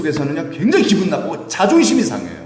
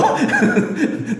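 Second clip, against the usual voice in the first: high, quick chuckle; amused laugh; 12 semitones higher than usual. Real, not acted. Preacher teasing congregation for weak amens, amused laugh. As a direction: laugh while teasing the listeners.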